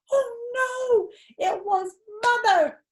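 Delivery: high, dismayed wail; mock panic; alarmed. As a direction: yelp it out, caught red-handed. Caught playing in toilet.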